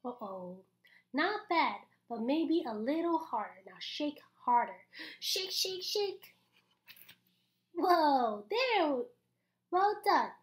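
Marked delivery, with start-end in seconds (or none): none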